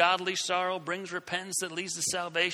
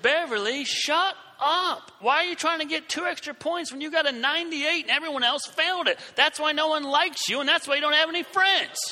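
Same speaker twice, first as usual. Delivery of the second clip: high, loud angry tirade; yelling at Beverly; 8 semitones higher, 4 dB louder than usual. Retold or imitated. Scolding the classmate in front of everyone, a high loud tirade. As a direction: tear into her at full volume. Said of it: "I said".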